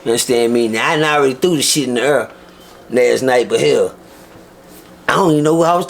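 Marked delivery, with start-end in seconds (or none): none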